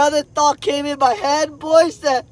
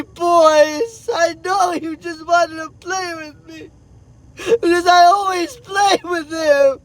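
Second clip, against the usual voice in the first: real crying; 3 semitones higher than usual. Real, not acted. Father telling his boys about his son, crying. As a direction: say it through real sobs, breaking off to breathe.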